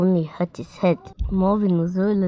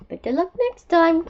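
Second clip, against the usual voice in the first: higher-pitched turtle character; encouraging turtle; 9 semitones higher than usual. A narrator's reading. Passing turtle to clumsy young beetle, encouraging.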